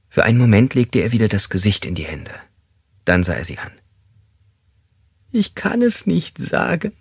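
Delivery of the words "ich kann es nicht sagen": high, slow, tearful refusal; sobbing. Acted. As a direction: sob it out, pitch right up. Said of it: "schluchzte er".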